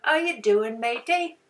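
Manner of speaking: cheery mock British "Hello, how you doing, matey"; lightly put-on British accent, friendly. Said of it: "like I'm British".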